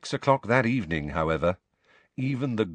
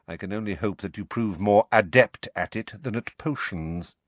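cold sneering teacher. Acted. Snape voiced by narrator.

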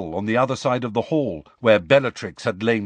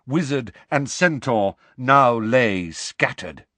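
normal talking voice

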